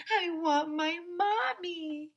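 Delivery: put-on high, drawn-out child's wail; very high, stretched-out kiddie yell; little kid yelling. A narrator's reading. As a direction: wail it slowly like a small kid. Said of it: "yelled some other little kid".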